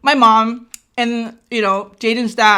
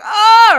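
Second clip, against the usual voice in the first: loud, high "ah" of panic; mock distressed cry; 10 semitones higher, 8 dB louder than usual. Retells panicking alone in labor.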